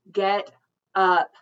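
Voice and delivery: loud sharp order to get up; loud command. Acted. Kaori ordering her sleepy sister out of bed, loud.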